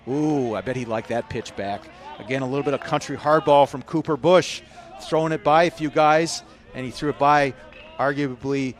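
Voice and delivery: usual speaking voice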